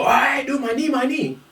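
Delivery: high-pitched imitation of a hurt sparring partner; mock pained protest. Sparring partner complains to a rough classmate, high mock protest.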